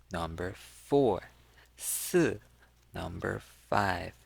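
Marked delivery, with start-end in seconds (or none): 1.8-2.4 si falling from high; fourth tone. Shown as a demonstration. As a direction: drop each "si" from the top. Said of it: "that is the fourth tone".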